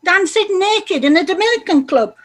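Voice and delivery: shocked yell. Acted. Wife confronts lover over video.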